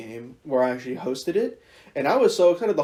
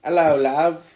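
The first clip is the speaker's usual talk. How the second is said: put-on British accent; 3 semitones higher, 6 dB louder, slower than usual. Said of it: "British girl".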